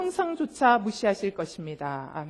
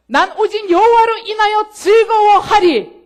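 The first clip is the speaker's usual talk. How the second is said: ringing proclamation; 8 semitones higher, 11 dB louder, slower than usual. Acted. Proclaiming scripture confession.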